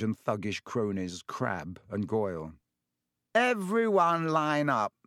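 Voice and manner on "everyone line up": mock roar. A narrator's reading. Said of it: "Malfoy roared to the crowd".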